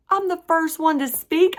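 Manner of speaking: high, loud shocked outburst; panicked surprise. Retold or imitated. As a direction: blurt it in disbelief. Shocked at being first.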